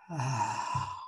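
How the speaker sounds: long soft sighing ah through the mouth; audible exhale. Shown as a demonstration. Leading a deep breath together, soft sighing exhale. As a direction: let the breath fall out as a sigh. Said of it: "Let it go".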